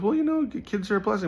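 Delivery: high-pitched mock friend voice; protesting friend. Retells friend arguing back.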